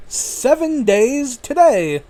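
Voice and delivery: grand announcer voice for the segment name; show announcer. Shown as a demonstration. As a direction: announce it like a big show title, drawn out. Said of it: "The segment is titled".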